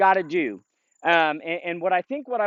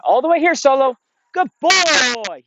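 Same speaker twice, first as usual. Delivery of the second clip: high, loud, bright-eyed dog praise; excited high praise; 9 semitones higher, 7 dB louder than usual. Real, not acted. Trainer calling his dog Solo in, high excited praise. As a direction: cheer the dog on as he comes back.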